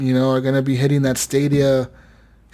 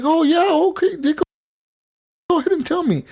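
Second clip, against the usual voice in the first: much higher, overly agreeable; too-nice brother; 13 semitones higher, faster than usual. Retold or imitated. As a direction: give in sweetly to the salesman.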